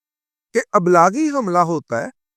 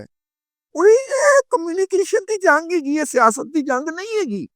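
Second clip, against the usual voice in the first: high calling out; 12 semitones higher than usual. Real, not acted. Calling out, mocking. (اونچی پکار کر)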